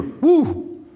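sudden high-pitched whoop; high woo. Real, not acted. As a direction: let out a sharp whoop at the stinging verse. Reacting to a stinging verse.

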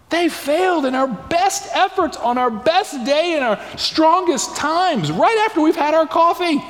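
high-pitched, building sermon delivery; impassioned preaching. Real, not acted. Preacher telling the congregation that our best efforts fail, impassioned.

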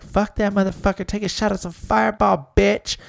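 high-pitched party friends hyping; mock girlfriends egging on. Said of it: "Her girls are gonna be like".

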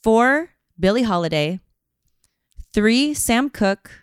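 big awards-show announcer voice; countdown announcer. Pretend. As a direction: call out each name like an MC. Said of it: "You got that voice".

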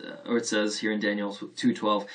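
ordinary talk

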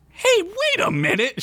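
high-pitched cry of sudden realization. A narrator's reading. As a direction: pipe up, suddenly catching on. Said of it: "she shouted in sudden realization".

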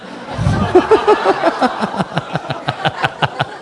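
airy laugh breaking out high; breathy high laugh. Real, not acted. Bursts out laughing at himself.

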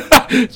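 loud, high burst of laughter. Real, not acted. Cohost teased about being a maniac, laughing.

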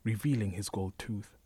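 plain reading voice

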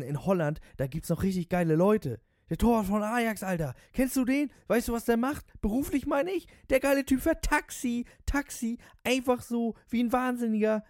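very high, loud breathless-with-awe gushing; raving cabbie. Acted. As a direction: escalate into manic fan worship. Voices the raving taxi driver.

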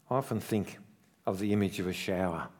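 ordinary talk

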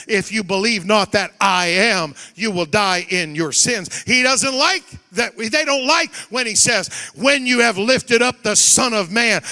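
loud, ringing preacher's cry, slowing at the end; fiery revival preaching; preaching at full force. Real, not acted. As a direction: thunder it over the congregation.

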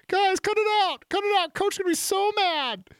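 very high, squeaky mock voice, pleading; panicked quarterback at bottom of pile. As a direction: squeal like a lost QB buried in the scrum.

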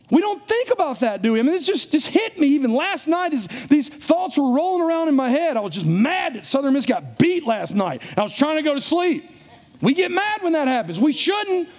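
high-pitched, worked-up pulpit delivery; fervent preacher confessing to the room; impassioned preaching. Real, not acted. Preaches at full force.

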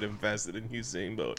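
put-on quiet, low Jamaican accent. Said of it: "Him said".

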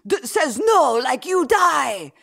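high, loud villain voice; hammed-up Soviet minister barking orders; cartoon Russian villain. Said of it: "talking like that".

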